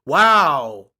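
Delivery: loud, drawn-out mock astonishment; big fake wow; sarcastic amazement. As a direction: act stunned by the obvious. Sarcastic reaction to coach's quote.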